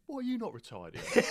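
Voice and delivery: very high, laughing cheeky bloke. Retold or imitated. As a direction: squeak it out high through a laugh. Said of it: "He went".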